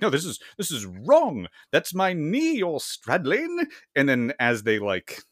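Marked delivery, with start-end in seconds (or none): 0.0-3.7 scandalized protest. Retold or imitated. Man in bed protesting two vampire women, mock scandalized. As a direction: object in a hammed-up raised voice. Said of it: "and he's like".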